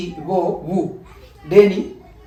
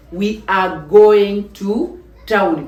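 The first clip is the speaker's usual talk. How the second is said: slow, deliberate English gloss; slow, careful translation for learners; slow teacher translation; 4 dB louder, slower than usual. Shown as a demonstration. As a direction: spell it out slowly for the class. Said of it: "Tugenda mu town".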